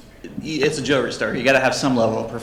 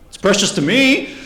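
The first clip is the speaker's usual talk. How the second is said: high, loud mock indignation; plaintive protest; 8 semitones higher, 7 dB louder than usual. Retold customer refused on steel ring, plaintive protest. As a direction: protest with pitch climbing at the end.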